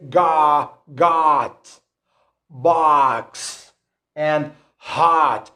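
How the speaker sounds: "got, box, and hot" with an open ah vowel; American unrounded O. Shown as a demonstration. Teacher drilling O words for learners, American vowel. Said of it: "we don't have rounded lips".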